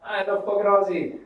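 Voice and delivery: high, loud mock shout of outrage; indignant New Zealander. Imagined New Zealander rejecting being called Australian, indignant shout. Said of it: "they'll be like".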